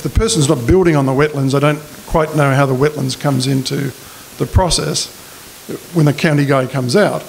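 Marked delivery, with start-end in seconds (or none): none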